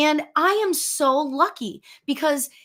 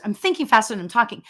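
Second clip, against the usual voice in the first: rushed apology, words tumbling out quickly; talking fast; much faster than usual. Real, not acted. Speaker excuses herself for racing thoughts, talking fast. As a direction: hurry through it. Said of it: "I'm talking fast".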